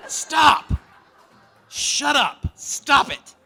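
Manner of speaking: high, loud laughing shout; panicked shout. Retold or imitated. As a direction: yell him to stop. Dad begs son to stop.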